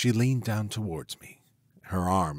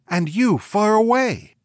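anguished cry; 12 semitones higher, 9 dB louder than usual. A narrator's reading. Witch's lament of her burning.

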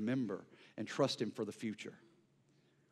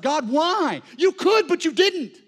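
much higher, louder cry of protest, slowing on the last words; angry at God; 14 dB louder, slower than usual. Retold or imitated. Pastor retelling his grief to the congregation, angry loud protest. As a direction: hurl the why at God. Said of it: "And I got mad".

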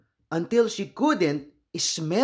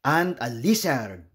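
ordinary delivery

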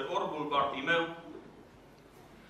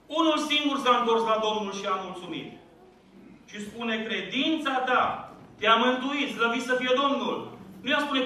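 raised, fervent sermon voice; preaching; 4 semitones higher than usual. Acted. Pastor urging his congregation, fervent raised preaching. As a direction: preach it out to the church with rising force.

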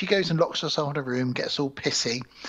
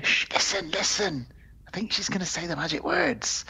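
eager little goblins; 3 semitones higher than usual. Acted. Goblins waiting for magic words, hushed excitement. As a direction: put on a conspiratorial goblin. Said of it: "these little goblins just sort of going".

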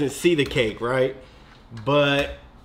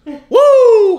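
loud high cheer; victory whoop; 11 dB louder than usual. Real, not acted. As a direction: celebrate the win.

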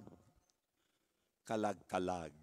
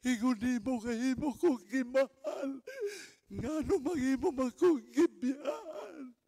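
mock lament; slower than usual. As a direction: wail like a left-behind lover. Mourner's lament voiced at grave.

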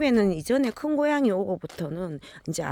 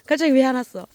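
loud high puzzled question; loud high why-did-you-stop; calling to the dog; 7 semitones higher, 7 dB louder, faster than usual. Real, not acted. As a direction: call out to the dog, louder and higher. Asking dog why it stopped.

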